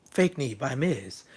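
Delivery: quiet, slow mocking aside under the breath; muttered aside. Shown as a demonstration. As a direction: say it softly and slowly, like a sneering aside: "Fake knee by Miz". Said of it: "the fake knee by Miz".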